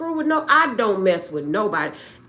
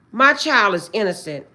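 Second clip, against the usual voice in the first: ordinary talk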